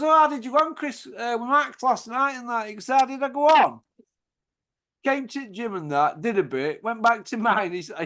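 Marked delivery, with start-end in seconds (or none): 0.0-2.7 eager question. Retold or imitated. Retelling himself asking Chris. 2.9-3.8 high, loud incredulous echo; exasperated echo. Retold or imitated. Chris to [name] about Max's night, incredulous echo.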